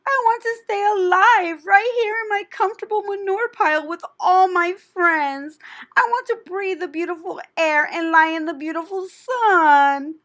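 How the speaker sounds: high, loud weeping plea; tearful pleading. A narrator's reading. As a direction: sob out the longing. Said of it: "Wilbur burst into tears".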